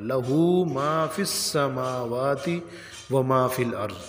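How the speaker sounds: لہو with short "la", long "huu"; correct recitation. Shown as a demonstration. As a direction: chant slowly, stretch ہو in لہو. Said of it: "ہو کو تھوڑا سا لمبا کرنا ہے". (ہو کو لمبا کر کے تلاوت)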